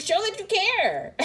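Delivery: a woman begging her partner for proof of his care, emphatic; emphatic plea. Retold or imitated. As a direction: demand it with rising insistence. Pleading climax of the quoted need.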